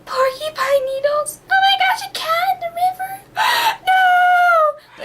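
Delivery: very high, half-crying distress; mock bawling. Imitating her child self sobbing at Homeward Bound. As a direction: wail it like a kid crushed by a movie. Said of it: "I would bawl".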